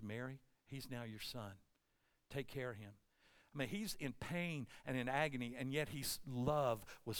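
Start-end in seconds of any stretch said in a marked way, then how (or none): 0.0-2.9 very soft, low voice; hushed tenderness. Acted. Jesus on the cross to Mary, hushed and low. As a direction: entrust him gently, almost under the breath.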